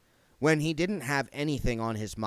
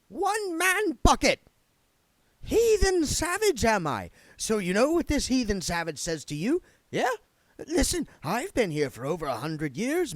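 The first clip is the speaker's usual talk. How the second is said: much higher, louder, offended ghost spoiling for a fight; indignant spirit guide; 11 semitones higher, 5 dB louder than usual. Acted. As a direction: bristle at the insult and threaten.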